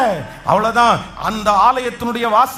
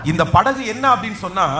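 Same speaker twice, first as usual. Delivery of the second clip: ordinary talk, nothing marked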